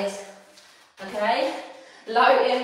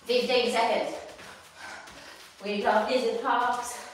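ordinary talk

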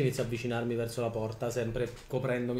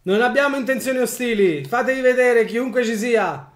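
loud, high call into the tower; 11 semitones higher, 10 dB louder than usual. Acted. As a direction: shout to whoever is hiding. Calling out to hidden goblins.

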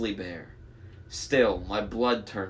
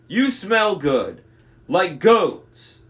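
loud, slow, raised monster voice; growing suspicion, loud and drawn out; sniffing monster; 7 semitones higher, 7 dB louder, much slower than usual. A narrator's reading.

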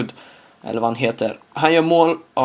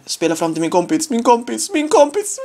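climbing higher each repeat; rising excitement; 9 semitones higher than usual. Real, not acted. Pass to friend.

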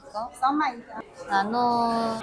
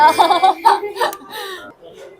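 loud laugh; 7 semitones higher, 6 dB louder than usual. Real, not acted. Embarrassed after ordering.

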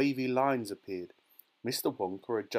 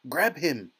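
louder, raised command; urgent order; 5 semitones higher, 6 dB louder than usual. A narrator's reading. Wonka orders them to grab the boy, urgent. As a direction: order it sharply. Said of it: "ordered Mister Wonka".

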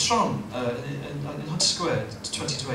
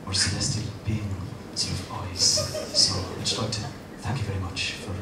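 much lower and softer, breathy chat-up voice; low seductive murmur; 10 semitones lower, 7 dB quieter than usual.

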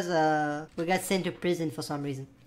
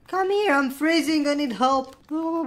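calling for help; 8 semitones higher, 4 dB louder than usual. Pretend. Puppy freezing, calling for help.

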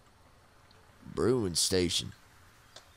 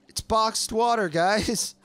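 excited; 9 semitones higher, 3 dB louder, slower than usual. Real, not acted. Excited loot find.

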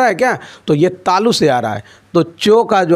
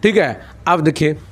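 normal talking voice

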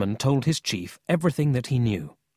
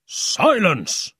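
loud, higher barked order; angry shout; 8 semitones higher, 6 dB louder than usual. A narrator's reading. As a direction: lose patience and shout them down. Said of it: "the police chief said again".

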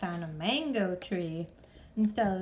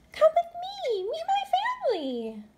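very high, bright inviting; eager invitation; 6 dB louder than usual. Acted. Stellaluna inviting the birds, eager. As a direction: call friends along happily. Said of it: "said Stellaluna".

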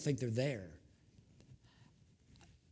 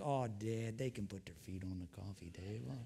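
much softer, lower, coaxing grandpa plea; soft indulgent grandpa; 7 semitones lower, 9 dB quieter than usual. Retold or imitated. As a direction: plead gently like a doting granddad. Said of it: "I'm like".

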